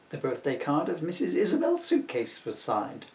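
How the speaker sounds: plain reading voice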